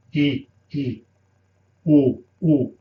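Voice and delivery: "E, e, o, o" with the mouth nearly closed; closed vowels. Shown as a demonstration. Teacher contrasts with the open accent, closed vowels. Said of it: "Otherwise, you just close".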